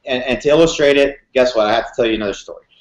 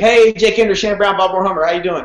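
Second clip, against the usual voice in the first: a raised, upbeat sales-call greeting; higher, cheery mock salesman voice; imitating the salesman; 5 semitones higher than usual. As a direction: play the chipper car salesman on the phone. Salesman's follow-up call retold.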